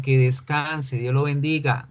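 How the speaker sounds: ordinary delivery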